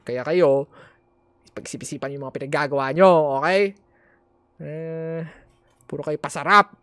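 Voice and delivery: loud scolding lecture. Real, not acted. Lecturing viewers.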